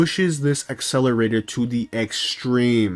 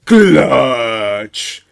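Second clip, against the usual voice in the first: loud, rough drawn-out yell; dramatic name reveal; 7 dB louder than usual. Acted. Storyteller revealing the brother driving, long rough yell.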